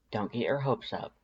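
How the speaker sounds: usual speaking voice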